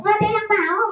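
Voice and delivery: high questioning puppet voice; puppet character. Acted. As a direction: echo it as a puppet. Puppet echoes presenter.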